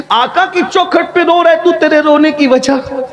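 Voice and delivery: high, insistent questioning; impassioned questioning. Acted. Questioning a weeping man. (بلند لہجے میں پُرجوش سوال)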